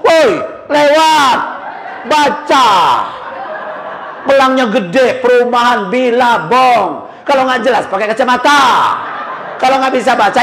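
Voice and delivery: a half-comic rough Lampung ojek shouting; rude Lampung driver. Retold or imitated. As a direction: bark at the lost driver. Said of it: "kata dia".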